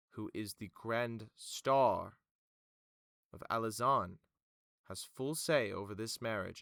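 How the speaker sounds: measured, emphatic formal official; Vima. Acted. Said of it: "Vima then goes".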